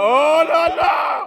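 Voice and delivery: drawn out high oh là là; high excited shout. Real, not acted. Laughing at friend walking.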